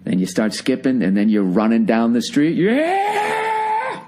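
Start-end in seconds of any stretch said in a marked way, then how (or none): 2.6-4.0 very high mock whoop of freedom; a joyful yell, hammed up; gleeful whoop. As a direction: cheer like you just escaped. Running down the street, breakup joy.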